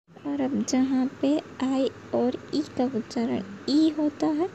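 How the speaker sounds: ordinary talk, nothing marked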